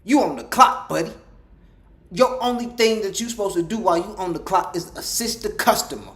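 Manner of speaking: preachy angry lecture; heated scolding. Acted. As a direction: lay into the clerk.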